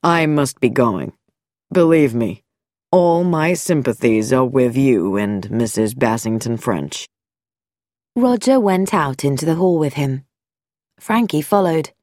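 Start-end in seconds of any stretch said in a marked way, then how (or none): 0.0-7.1 lower, smooth-spoken doctor voice; a calm doctor hiding something; sinister doctor. Acted. Dr Nicholson voiced.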